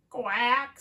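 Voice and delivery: loud imitated quack; duck quack. Duck answers the mask riddle, loud quack. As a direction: give one big duck quack. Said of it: "says the duck".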